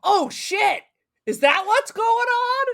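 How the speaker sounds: high, loud startled "Oh shit"; retold surprise. Host retells his shock at the twist, high and startled. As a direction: replay the jolt of realising. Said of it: "I was like".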